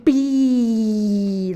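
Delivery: very slow, drawn-out letters A and B; over-enunciated alphabet. Shown as a demonstration. Voice actor showing her alphabet warm-up, slow and enunciated. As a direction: stretch out "A" and "B" letter by letter, every sound crisp. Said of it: "Just very enunciate".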